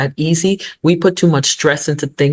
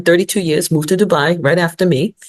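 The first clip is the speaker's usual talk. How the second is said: ordinary talk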